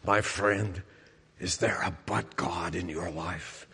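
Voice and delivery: put-on low, hushed Welsh-accented preacher; soft, low Welsh English accent; Martin Lloyd-Jones. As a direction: imitate a Welsh preacher, quiet and deep. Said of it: "in his Welsh English accent".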